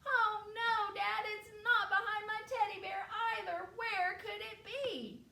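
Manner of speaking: put-on high, wailing child's complaint; high-pitched, hammed-up panic over a lost shoe; dismayed little girl. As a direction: wail to Dad in a high girl's voice. Girl upset, shoe still missing.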